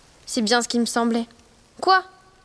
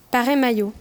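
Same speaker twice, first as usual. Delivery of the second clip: ordinary delivery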